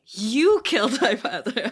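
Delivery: mock accusation. Host mocking prince confronting a random ogre, loud mock accusation. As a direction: overplay the prince's blind accusation.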